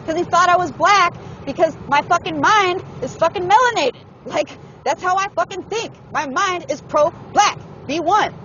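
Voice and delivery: heated, high-pitched venting; real worked-up yelling. Real, not acted. Venting about being misread over the page, yelling. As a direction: let frustration push the voice way up high. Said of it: "sorry for yelling".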